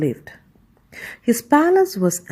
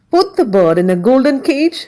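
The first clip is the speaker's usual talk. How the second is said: higher, louder emperor exclaiming; emperor cries out; 5 semitones higher, 5 dB louder than usual. A narrator's reading. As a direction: call out the order eagerly. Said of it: "he cried".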